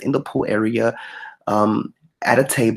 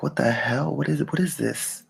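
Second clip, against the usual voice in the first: hushed bewilderment; 3 semitones higher, 6 dB quieter than usual. Retold or imitated. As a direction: retell a baffled inner reaction under your breath. Bewildered reaction to voice in head.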